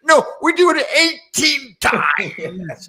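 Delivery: very high, slow, loud Scottish brogue; Scottish accent punchline. Retold or imitated. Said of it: "the Scottish accent".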